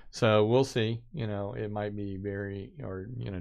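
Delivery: ordinary talk, nothing marked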